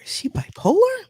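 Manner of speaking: breathless whisper to yourself; hushed aside. Retold or imitated. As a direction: whisper it like a private thought. Aside thought, voiced.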